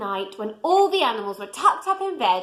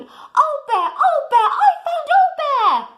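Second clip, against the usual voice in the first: much higher, louder joyful cry; excited shout; 6 dB louder than usual. A narrator's reading. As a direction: jump with excitement and call out. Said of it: "he shouted".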